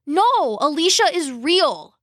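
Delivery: high, emphatic insistence; insisting too loudly. Acted. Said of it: "a little too loudly".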